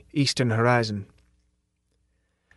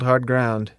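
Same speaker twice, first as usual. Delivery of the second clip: plain reading voice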